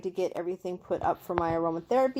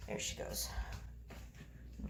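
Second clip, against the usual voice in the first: breathy whisper, much softer; whispered aside; 17 dB quieter than usual. Real, not acted. Owner whispering as the pregnant cat slips away. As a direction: whisper so the cat isn't startled.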